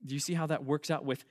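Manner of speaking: ordinary talk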